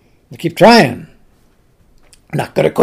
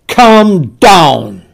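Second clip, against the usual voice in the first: stern command; 4 dB louder than usual. Acted. King gives a command.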